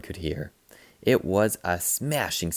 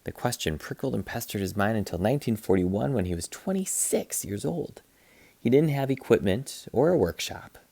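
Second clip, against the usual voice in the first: plain reading voice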